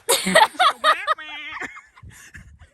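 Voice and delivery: loud high burst of laughter. Real, not acted. Laugh at sex joke.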